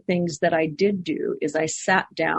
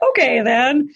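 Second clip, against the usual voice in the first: higher and louder, bursting with energy; lively, raised retelling; animated retelling; 8 semitones higher, 6 dB louder than usual. Retold or imitated. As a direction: push it up like a sudden jolt. Retelling her resigned reaction.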